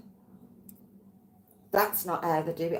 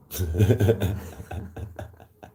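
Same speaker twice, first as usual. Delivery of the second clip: loud laugh; 13 semitones lower, 7 dB louder than usual. Real, not acted. Laughing at [name] story.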